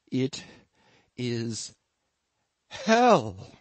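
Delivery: halting words, then a burst on "hell"; strained pauses building to an outburst; muffled outburst. A narrator's reading. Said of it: "he burst out in a muffled voice".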